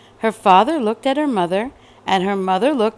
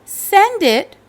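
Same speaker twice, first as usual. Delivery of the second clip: much higher, louder order; eager little girl; 10 semitones higher, 6 dB louder than usual. Acted. Cassandra telling the lady where to send it, high and loud. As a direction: say it like an excited kid. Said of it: "said Cassandra".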